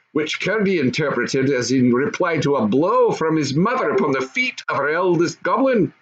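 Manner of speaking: plain reading voice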